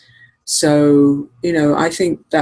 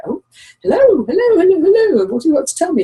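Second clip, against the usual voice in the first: put-on high, loud sing-song baby talk to a dog; gushing high voice for a little dog; cooing at the dog; 13 semitones higher, 5 dB louder, faster than usual. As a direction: coo up high like greeting a puppy. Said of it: "And I go".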